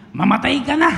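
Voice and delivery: high, loud squawking parrot line. Retold or imitated. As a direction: screech it like a rude parrot. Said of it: "Ang sabi po ng parrot". (matinis at malakas na boses ng loro)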